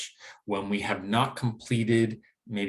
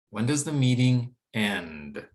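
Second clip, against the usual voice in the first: slow demonstration, long falling END; drop at the end; slower than usual. Shown as a demonstration. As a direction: draw out "end" as it drops. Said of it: "we drop at the end there".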